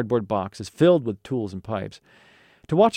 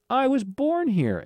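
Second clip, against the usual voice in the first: much higher, slow, drawn-out protest; indignant exclamation; 13 semitones higher, slower than usual. Acted. Boy protesting to vendor he's from Chicago, exclaimed. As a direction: exclaim it high, stretching the words. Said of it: "Alan exclaimed".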